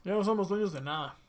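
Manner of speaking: talking while eating; words round a bite of food; mouth full. Real, not acted. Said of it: "Sí, se nota". (con la boca llena)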